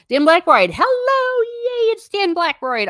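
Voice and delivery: very high, loud cheer; excited greeting. Real, not acted. Streamer greeting chat member Dan Blackroyd, a high cheering welcome. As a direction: sing out the welcome like a delighted host.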